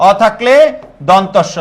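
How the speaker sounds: ordinary delivery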